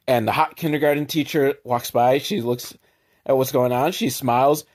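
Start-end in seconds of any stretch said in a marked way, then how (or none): none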